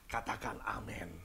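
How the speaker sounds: slow whispered amen call; slow hushed whisper. Real, not acted. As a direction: breathe it to the congregation.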